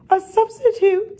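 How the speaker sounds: high, loud excited exclamation; delighted. A narrator's reading. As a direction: pass on thrilling news, pitched high. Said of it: "They were delighted".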